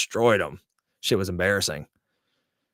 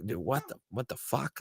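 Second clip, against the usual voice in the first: much softer, rising in disbelief; soft baffled aside; 3 semitones higher, 10 dB quieter than usual. Retold or imitated. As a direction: mutter it in confusion. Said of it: "And you're like".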